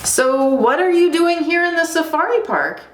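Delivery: high-pitched inquisitive animals; curious animals. Acted. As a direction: ask it up high, full of curiosity. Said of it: "asked the animals".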